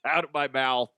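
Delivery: ordinary delivery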